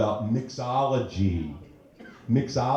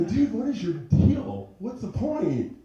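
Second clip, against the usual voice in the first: exasperated; 12 semitones higher, slower than usual. Retold or imitated. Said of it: "And I go".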